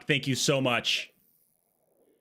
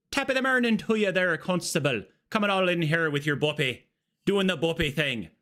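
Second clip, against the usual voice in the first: lilting put-on Irish greeting; stage Irish accent; 6 semitones higher than usual. Streamer greeting a constable, mock Irish accent.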